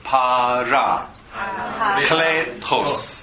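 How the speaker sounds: "Parakletos" very slow, each syllable apart; four separate syllables. Shown as a demonstration. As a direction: spell out "Pa-ra-kle-tos" by ear, beat by beat. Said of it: "pa四个音节".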